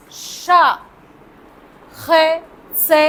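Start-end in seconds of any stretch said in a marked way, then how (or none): none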